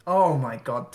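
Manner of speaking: loud, startled exclamation; surprised outburst. Real, not acted. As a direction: blurt it out in live-commentary shock. Startled by unexpected unit loss.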